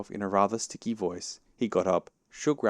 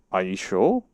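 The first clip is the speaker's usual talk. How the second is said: louder, rising incredulous question; more surprised; 7 dB louder than usual. A narrator's reading. Rabbit doubting it is Pooh Bear, surprised. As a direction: question it with a lift at the end. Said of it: "still more surprised".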